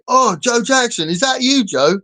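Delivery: high, loud star-struck fan call; mock an excited fan spotting a celebrity; gushing fan. Imitating fans who approach a recognition-shy singer, high excited gushing. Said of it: "go up to him and say".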